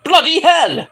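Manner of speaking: put-on high, loud British accent exclamation; British accent burst; mock British shout. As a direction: bark it out in a cartoon British accent. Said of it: "with a British accent".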